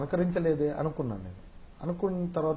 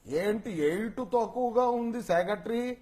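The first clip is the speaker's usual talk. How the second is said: slow, raised boss impersonation; Rao Gopala Rao; 5 semitones higher, slower than usual. Acted. Boss finds money short, slow high questioning impersonation. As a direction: stretch the question in a higher character voice. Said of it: "Rao Gopalarao గారు".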